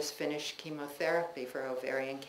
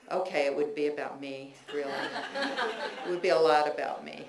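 laughing; 4 dB louder, faster than usual. Real, not acted. Laughing at herself mid-reading.

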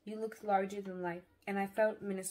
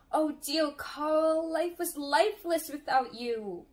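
raised, loud, drawn-out yearning; heartfelt lament; 6 semitones higher, 6 dB louder, slower than usual. A narrator's reading. Sister writes to brother away at war, lamenting. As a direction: cry out to the absent brother.

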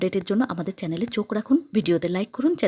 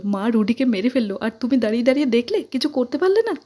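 fast, high, louder reproach; grieving sister accusing sister, fast and high; tearful accusation; 4 semitones higher, 4 dB louder than usual. A narrator's reading. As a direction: hurl the blame at her sister.

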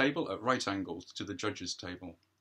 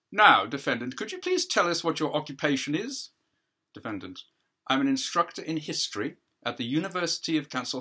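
louder, crisp cross-examining counsel; counsel questioning; 5 semitones higher, 6 dB louder than usual. Acted. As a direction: press the witness, formal and projected.

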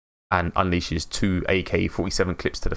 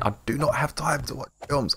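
higher, insistent retold protest; 6 semitones higher than usual. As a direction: protest it as a repeated complaint. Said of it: "And I keep saying".

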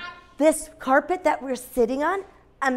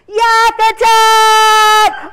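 long drawn-out high shout; playful attack cry; 11 semitones higher, 11 dB louder, much slower than usual. Pretend. Teacher sets children running past the yak, playful shouted attack cry. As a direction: holler it loud and high, stretching each word. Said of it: "When I say yak attack".

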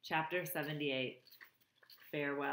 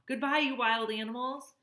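raised, higher farewell call; robot calling a farewell to the animals, raised voice; calling out goodbye; 7 semitones higher, 4 dB louder than usual. A narrator's reading. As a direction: call it out across the crowd. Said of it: "Roz's voice echoed through the gray mist".

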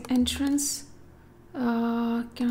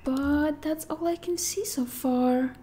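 ordinary talk

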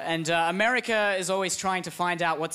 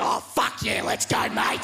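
rowdy Aussie; 4 semitones higher than usual. Acted. Australia eager to join.

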